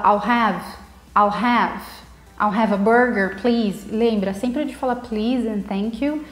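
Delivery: ordinary talk, nothing marked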